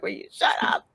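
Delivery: hushed, voiceless imitation of a yell; stifled mock yell at the screen; mock audience yelling. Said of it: "like, yelling at him".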